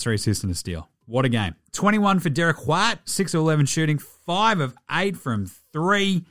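ordinary talk

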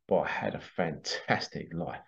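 low, soft pirate voice, slow; lightly voiced pirate, unhurried and low; pirate character. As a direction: savour it like a contented old rogue. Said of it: "like this pirate character".

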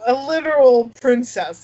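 ordinary talk, nothing marked

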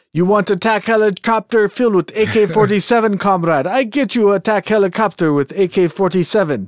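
exaggerated Russian accent, high pitched; hammy Russian arms dealer. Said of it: "the worst Russian accent I've ever heard".

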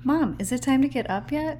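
lightly voiced child, high and loud; a hint of a pleading young child; little boy asking mom. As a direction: imitate an eager kid calling for mom. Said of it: "he'll come out and he'll be like".

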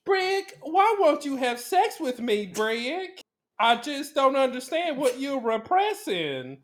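high, pleading film-heroine voice; Elizabeth Taylor impression. Wife pleading with Brick in movie, high theatrical accent. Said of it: "Elizabeth Taylor accent in that movie".

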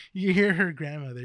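normal talking voice

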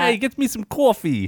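high put-on Italian order for coffee; mock Italian accent. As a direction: ham up an Italian goofball. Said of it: "This is Italian, ain't it?".

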